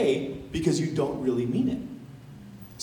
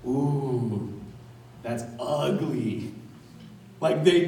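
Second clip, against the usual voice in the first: ordinary talk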